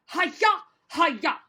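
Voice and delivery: short, loud chopping shout; playful martial-arts yell; mock karate cry. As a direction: chop the air with each cry. Karate-chop cries for section by section.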